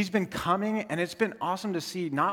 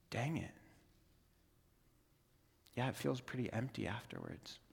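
quietly deflated admission; 4 semitones lower, 14 dB quieter than usual. Retold or imitated. Guy admits emptiness, retold.

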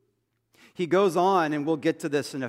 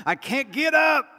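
high, loud mock cry of people stuck on their knees, laughing; struggling kneelers; 6 semitones higher, 7 dB louder than usual. Pastor mimicking his congregation stuck kneeling, laughing mock shout. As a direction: ham up old folks who can't get up. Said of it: "half the congregation's like".

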